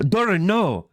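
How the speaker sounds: high, hammed-up wail of a bad actress; mocking the wife's stiff, overdone plea; mocking bad acting. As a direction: parody a wooden actress pleading. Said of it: "his wife cannot act for her life".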